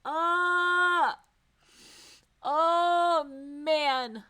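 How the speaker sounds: loud high sobbing breaths; sobbing, trying to gather yourself; crying. Real, not acted. Player crying over a character leaving, sobbing. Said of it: "give me a minute".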